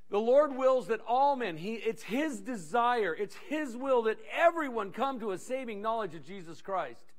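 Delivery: normal talking voice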